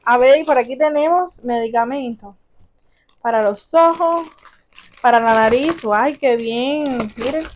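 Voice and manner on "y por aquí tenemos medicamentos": ordinary delivery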